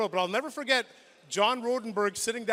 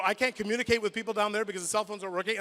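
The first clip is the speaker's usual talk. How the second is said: ordinary talk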